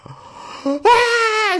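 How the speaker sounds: very high, loud scared yelp; child's terrified scream. Retold or imitated. Son seeing his father's buzzed head, high terrified scream. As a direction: scream like a startled little boy. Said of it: "he's just like".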